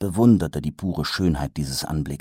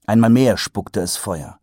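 ordinary delivery

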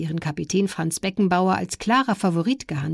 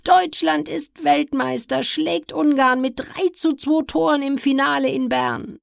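cracking commentator voice; 9 semitones higher, slower than usual. Real, not acted. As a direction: shout it's over, voice breaking with joy. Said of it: "die sich überschlagende Stimme des Kommentators".

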